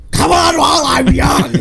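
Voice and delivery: high, loud yell; hammed-up impatient holler; screaming. Fan re-enacts the Grinch demanding his award, screaming. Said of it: "Just screaming".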